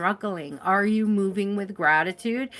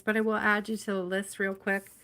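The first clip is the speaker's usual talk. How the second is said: ordinary talk, nothing marked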